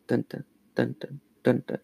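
soft heartbeat dun dun. Pretend. Heartbeat sound effect.

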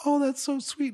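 put-on very high, gushing child voice; delighted child voice. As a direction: squeal it like a thrilled kid. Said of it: "he was like".

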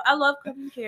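ordinary talk

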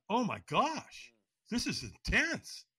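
high, slow awed gasp; slow, stunned. Retold or imitated. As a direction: draw it out in disbelief. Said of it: "And I'm like".

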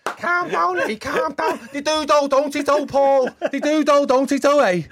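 high, loud mock Scouse accent. Teasing a friend's failed [name], in mock Scouse accent. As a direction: ham up a high-pitched comedy Scouse. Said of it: "done [name] as [name] from the Scousers".

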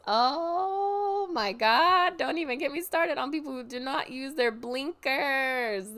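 loud, high, drawn-out "oh my God" easing into a worked-up rant; exasperated rant. Real, not acted. Driver venting about people without blinkers, exasperated. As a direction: let the exasperation burst out high.

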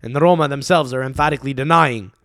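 ordinary talk

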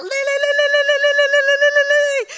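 high, loud ululating shout; loud high trilled cheer; excited crowd cry. Retold or imitated. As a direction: chant it like a joyful crowd. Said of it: "pastors go running out".

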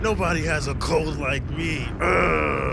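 loud, high hammed-up whine; milk the cold for sympathy; dramatic sick complaint. Hams up his cold.

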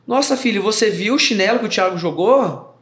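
imitated coaxing mother. Mother gets son to look at thrown slipper, playful wonder. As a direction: act out a mother feigning wonder to a child.

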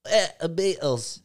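[name] impression. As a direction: swagger into a British rock-star accent. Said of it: "turn into [name]".

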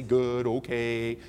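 slow, low, resigned mumble; tired stock answer. Retold or imitated. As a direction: drag out the weary reply. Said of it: "You might say".

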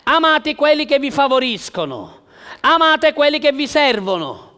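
loud, high, fervent preaching; preaching at full force. Acted. Pastor exhorting the church on love, preaching at full force. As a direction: drive it home to the congregation.

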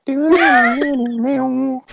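high drawn-out vocal twang; imitated banjo strum. Bard strums his banjo before singing, imitated twang. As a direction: mimic a banjo chord with your voice.

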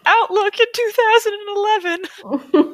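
exasperated high-pitched protest; incredulous outburst. Real, not acted. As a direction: squeal it in outraged disbelief, half laughing. Incredulous rant about the book.

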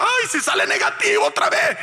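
high-pitched imitation of a tearful wail; mock crying. Husband imitating his wife fearing another negative test, mock crying. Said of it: "mi esposa lloraba".

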